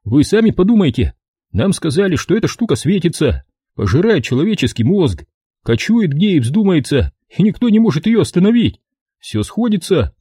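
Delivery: a wiseacre spinning his theory; cocky know-it-all soldier. A narrator's reading. Evans voiced by narrator.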